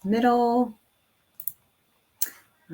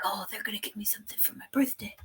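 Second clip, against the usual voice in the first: hushed son impression; 7 dB quieter, much faster than usual. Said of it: "And so now he's like".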